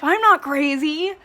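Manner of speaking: high, loud mock protest; mock-frantic denial. Reader mocks a character holding a knife, hammed-up frantic denial. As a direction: act out an over-the-top indignant denial.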